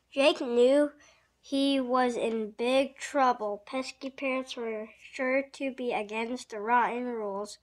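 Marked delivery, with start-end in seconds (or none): none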